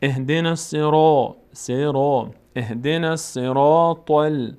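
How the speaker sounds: "الصراط" with a dark, rounded "ro"; full heavy R. Shown as a demonstration. Teacher shows the letter read full, slow heavy-R recitation. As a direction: chant slowly, filling out the ر of "الصراط".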